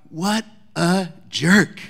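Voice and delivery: slow, drawn-out sneer; scornful teen. Retold or imitated. Eighth grader reacting to teacher's talk, scornful and slow. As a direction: scoff like an annoyed thirteen-year-old.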